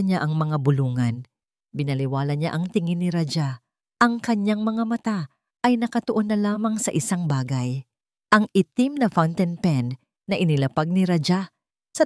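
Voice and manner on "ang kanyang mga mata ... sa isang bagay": plain reading voice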